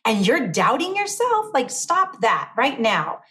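imitated telling-off, pitched high at first; exasperated scolding. Champions wanting to slap her out of self-doubt, incredulous scolding. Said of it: "they wanna slap me and say".

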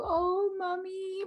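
put-on high, slow, soft cooing of a child; compassionate kids. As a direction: coo it tenderly like a sweet little kid. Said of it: "showing so much compassion".

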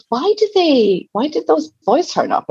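put-on curious child asking high; a young girl asking her mum, high and wondering; high child's question. Daughter asking mum about the boys, high childlike voice.

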